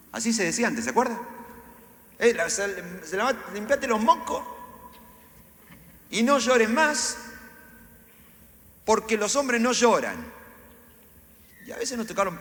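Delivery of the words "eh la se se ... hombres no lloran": stern father scolding. Retold or imitated. Father scolding his crying son, loud mock scolding. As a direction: bark it like a gruff dad telling a kid to stop crying.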